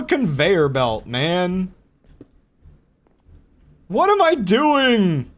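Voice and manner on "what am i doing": slow, high, loud disbelief; exasperated. Real, not acted. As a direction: drag out 'What am I doing' in despair. Exasperated repeat.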